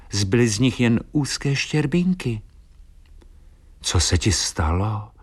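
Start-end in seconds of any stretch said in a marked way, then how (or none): none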